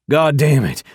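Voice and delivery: loud, high furious outburst; angry curse. Acted. Biker raging that he cannot call, loud furious curse. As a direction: blow up at the useless phone. Furious after phone fails.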